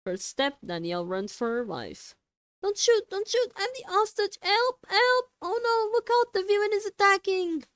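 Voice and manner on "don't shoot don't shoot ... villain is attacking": high, loud mock screaming for help; hammed-up frightened hostage; fake panicked hostage. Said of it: "Not bad, not bad, it will play".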